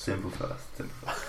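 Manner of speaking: hushed, breathy repeat under the breath; whispered. Real, not acted. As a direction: whisper it to yourself.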